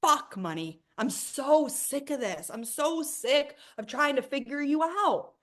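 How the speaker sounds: high, loud, slow furious rant at money; yelling angrily at money; mean it. Shown as a demonstration. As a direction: let the frustration out, really mean it. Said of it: "say it like you mean it".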